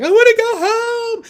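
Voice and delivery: put-on very high, loud, drawn-out child's wail; loud high crying child; wailing kid. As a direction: howl it like a toddler in a meltdown. Kids screaming in meltdown.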